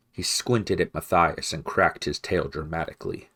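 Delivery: ordinary delivery, nothing marked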